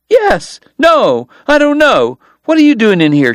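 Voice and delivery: confused, then demanding; Kate flustered. Acted. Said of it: "she demanded".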